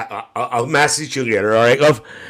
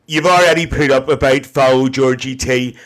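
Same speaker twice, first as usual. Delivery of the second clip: ordinary delivery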